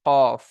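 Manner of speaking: কফ said short and loud, deep from the back of the tongue; qaf demo. Shown as a demonstration. Teacher demonstrating qaf's articulation point, loud crisp demo. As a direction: bark out কফ crisply from the throat's back. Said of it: "উচ্চারণ হয়".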